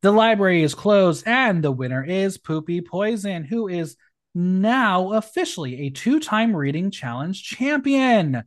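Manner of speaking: showy announcer declaring a champion; game-show style proclamation; winner announcement. Acted.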